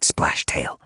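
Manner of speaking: whispered name. Acted. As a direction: hiss his name under breath. Plotting a murder.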